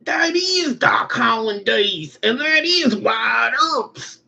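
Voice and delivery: high, slow, strained cowboy voice; drawn-out mock Western cry; Tombstone western voice. Parodying Tombstone's opening, in a high, slow mock cowboy cry. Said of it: "That's the whole beginning of Tombstone".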